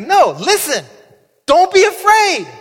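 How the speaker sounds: hammed-up calm-down plea; mock reassuring Jesus. Jesus hushing terrified disciples, high and loud.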